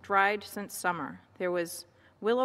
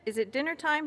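higher-pitched calling question; mild bellow; 8 semitones higher, faster than usual. A narrator's reading. Sheep asking Moose about dinner, mild bellow. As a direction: call it out high, without much force. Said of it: "he bellowed".

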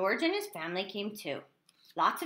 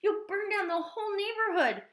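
a high, louder alarmed cry; 12 semitones higher, 3 dB louder than usual. Acted. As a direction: warn him the house will burn. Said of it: "cried his mother".

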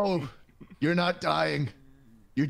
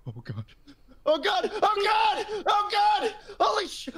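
put-on panicked old man; 5 semitones higher than usual. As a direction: yelp it as he flies off. Old man launched into the sky.

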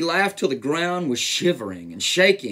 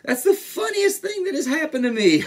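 high voice full of laughter; tickled storybook elephant; giggling; 12 semitones higher, 4 dB louder than usual. A narrator's reading. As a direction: squeal it through giggles. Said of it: "He began to giggle".

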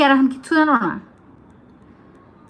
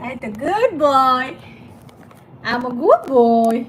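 slow, drawn-out praise for the bird; much slower than usual. Real, not acted. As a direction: stretch each word like praising a pet.

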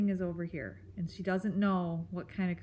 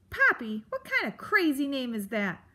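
loud, higher, incredulous grump; scornful; 6 semitones higher, 5 dB louder than usual. Acted. Grump mocks the mouse's name, scornful. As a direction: scoff with a falling, punched first word.

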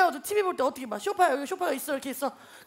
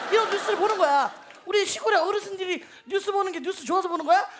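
usual speaking voice

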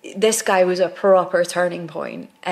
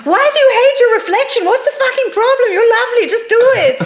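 much higher and louder, laughing while mock shouting; exasperated director; 6 dB louder, faster than usual. Director berating Marvin over his reflection, mock exasperated. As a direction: demand what the problem is.

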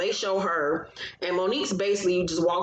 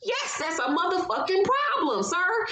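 laughing outrage; 9 semitones higher than usual. Real, not acted. Laughing at the absurdity.

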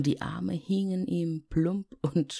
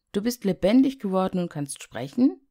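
put-on astonished boy; 5 semitones higher, 6 dB louder, faster than usual. Heinz staunt über Schneemann.

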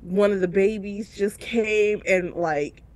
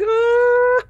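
loud, high "oh" melting into laughing sobs; high mock-tearful wail; 5 dB louder than usual. Teacher hugged by a little one, imitated tearful coo. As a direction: imitate happy tears bursting out. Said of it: "like the really funny tears".

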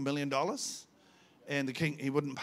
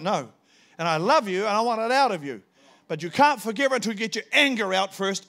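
loud, driving sermon voice, higher; fiery preaching; 4 semitones higher, 7 dB louder than usual. Acted. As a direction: preach it out full force. Preaching God's words at full force.

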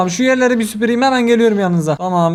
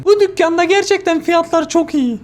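higher, lightly voiced sweet old lady; old lady customer; 9 semitones higher than usual. Gamer voicing the old customer to the cashier, sweet granny voice. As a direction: play the pleased granny at the till.

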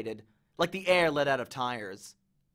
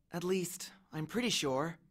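muttered; 9 dB quieter than usual. A narrator's reading. Annabeth doubts her plan, muttering. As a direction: mutter it half to yourself. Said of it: "she muttered".

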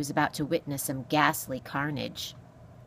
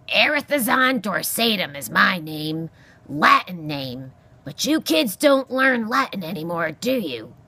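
loud, irascible porcupine voice; grumpy old porcupine; 2 semitones higher, 6 dB louder than usual. Acted. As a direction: scold as a crotchety old animal.

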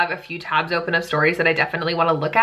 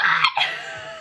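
high laugh. Real, not acted. Cringing at the fanfic.

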